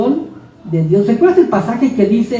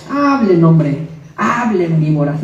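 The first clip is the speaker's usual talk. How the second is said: scornful beggar; 5 semitones lower, 4 dB quieter, slower than usual. Acted. A blind beggar shrugging off gossipers, scornful and slow. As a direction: lower and slow, brushing off the gossips.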